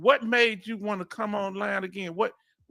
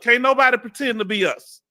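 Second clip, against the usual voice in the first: proud declaration; 6 semitones higher, 7 dB louder than usual. Real, not acted. Proud Southern boast.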